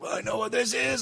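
high-pitched mock dismay; retold alarm. Smoker recognising laced weed one other time, high mock alarm.

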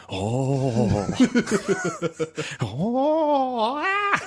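high mock newborn wail, drawn out; mock baby cry. Joking how babies cry at birth, mock wailing. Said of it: "they cry like".

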